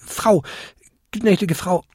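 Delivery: high, hesitant little voice; stammering apology. A narrator's reading. Kobold begs the old lady's pardon, stammering. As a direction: grope for the proper title, halting. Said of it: "stammelte der kleinste Winterkobold".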